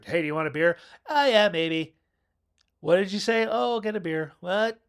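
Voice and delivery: shouted chat through the bathroom door; mock loud chat. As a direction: call across the room. Acted beer exchange.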